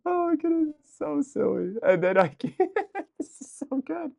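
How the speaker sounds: high squealing delight, laughing through it. Real, not acted. Streamer squealing with laughter over a funny video, delighted. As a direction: squeal with glee at the funny clip.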